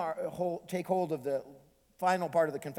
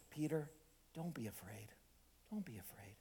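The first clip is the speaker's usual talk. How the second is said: tender reassurance; 12 dB quieter than usual. Acted. Jesus calms frightened Peter, tender and gracious. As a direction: soothe gently, almost whispering. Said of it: "Jesus is very tender".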